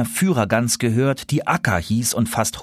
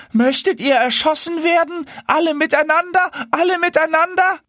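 outraged. Acted. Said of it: "ganz außer sich und schrien".

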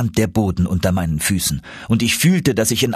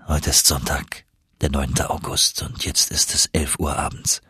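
lower, softer, tender voice; soft and soothing; 4 semitones lower, 4 dB quieter than usual. Acted. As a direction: hush him gently to sleep. Uncle soothes injured nephew.

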